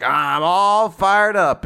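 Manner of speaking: playful anime fired-up line; mock fired-up. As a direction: stretch each word like an anime hero powering up. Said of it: "Verica's like".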